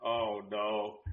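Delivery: slow, hushed retold dismay; quiet dread. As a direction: let it sink in, softly. Retelling dread at seeing bugs.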